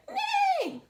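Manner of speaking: high-pitched whinnying neigh; neighing dog. Acted. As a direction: neigh like a little horse. Mixed-up animal sound in story.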